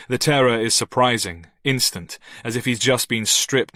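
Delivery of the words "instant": ordinary delivery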